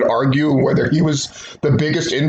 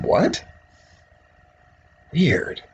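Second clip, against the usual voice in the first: ordinary talk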